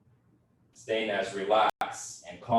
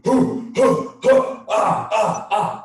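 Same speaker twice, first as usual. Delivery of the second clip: loud, much higher, breathy battle cries; 12 semitones higher, 11 dB louder, slower than usual. Shown as a demonstration. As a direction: chant it out like warriors before a fight.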